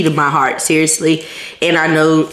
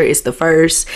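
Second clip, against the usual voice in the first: ordinary talk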